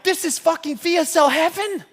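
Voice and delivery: high, loud burst of retold delight; excited self-quote; thrilled exclamation. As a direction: gush it like you just found paradise. Retold thrill at finding the company.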